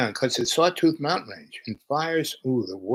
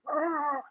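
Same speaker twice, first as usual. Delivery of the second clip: mock dismayed gasp; 6 dB quieter than usual. Triple Virgo reacting to messy place.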